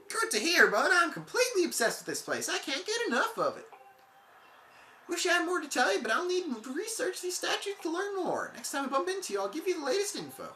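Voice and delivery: high, excited surfer dude. Acted. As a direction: gush like a stoked surfer. Voicing excited Goron NPC.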